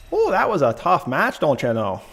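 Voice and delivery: folksy upper Midwest accent, played up; Minnesota accent. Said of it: "trying to load in my program for Canadian accents".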